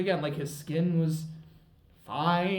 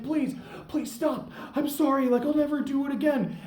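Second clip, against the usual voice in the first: higher, pleading mock panic; begging; 11 semitones higher, 4 dB louder than usual. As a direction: beg to be spared, pitched up. Man begging during fight.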